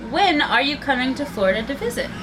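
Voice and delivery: ordinary talk, nothing marked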